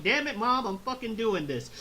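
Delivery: Gohan. Acted. Son defying his mother's study orders, mildly hammed-up defiance. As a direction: talk back to Mom with a light acted outburst. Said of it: "And he's just like".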